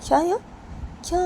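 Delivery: high, loud, shrill outrage; piercing high tirade of a furious girlfriend; Payal outraged. Acted. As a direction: scold him with no shame allowed. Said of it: "तो पायल पूनम को बोलती".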